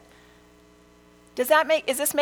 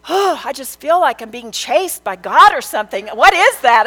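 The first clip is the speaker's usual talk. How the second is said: son confused; 4 semitones higher, 9 dB louder than usual. Retold or imitated. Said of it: "And he said".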